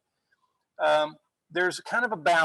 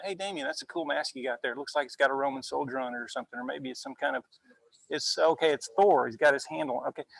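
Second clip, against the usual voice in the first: just chat casually, like across a table; casual conversation tone; 3 semitones lower than usual. Shown as a demonstration. Teacher chatting to student Damien about his mask, casual quick low voice. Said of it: "So see, I'm kinda talking fast, I'm talking a little bit lower".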